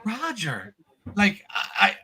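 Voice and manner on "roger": put-on higher, softer voice of a starstruck child; awestruck little kid. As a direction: imitate a small boy in awe. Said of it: "his eyes light up like he just saw".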